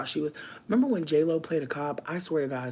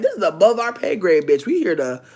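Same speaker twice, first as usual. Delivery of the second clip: loud, brash mock police declaration; loud hammed-up cop boast; 3 semitones higher, 12 dB louder than usual. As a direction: belt it like a smug cop. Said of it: "they were like".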